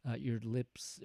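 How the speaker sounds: ordinary talk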